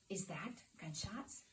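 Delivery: hushed and slow, frightened; scared whisper. Retold or imitated. Wife waking husband at gunfire, hushed scared question. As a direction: ask it under your breath, slowly. Woken by gunshots at night.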